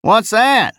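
high, drawling simple-minded voice; drawly jejune voice. Acted. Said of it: "the Hebe driver inquired".

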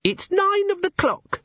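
very high, drawn-out time announcement; imp organiser. A narrator's reading. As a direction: squeak the hour like a tiny living clock. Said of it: "said the organiser".

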